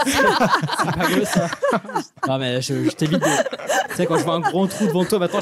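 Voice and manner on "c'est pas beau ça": slow and higher, laughing through it; laughing aside. Real, not acted. Teasing his co-host about a dark joke, slow and laughing. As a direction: drag it out while still laughing.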